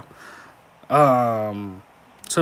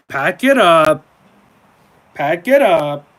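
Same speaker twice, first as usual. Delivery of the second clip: loud, higher dismissive call; mock taunt; 5 semitones higher, 11 dB louder, slower than usual. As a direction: tell him to pack it up with a jeer.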